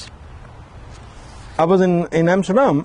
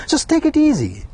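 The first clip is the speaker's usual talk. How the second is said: another guest; 12 semitones higher than usual. Retold or imitated. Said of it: "another voice came".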